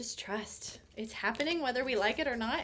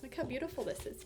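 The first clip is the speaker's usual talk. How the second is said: normal talking voice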